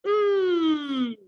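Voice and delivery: a long humming mm with the lips closed; M sound. Shown as a demonstration. Teacher modelling the M sound for learners, closed-lip hum. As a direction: hum it through the nose. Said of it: "M says".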